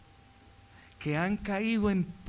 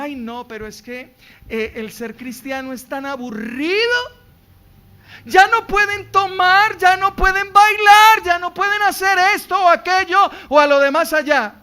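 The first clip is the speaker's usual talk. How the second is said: scoffing voice, much higher and louder on the list; mock the naysayers, ramping up; mocking critic; 10 semitones higher, 13 dB louder than usual. (burla de quien critica, más agudo y fuerte)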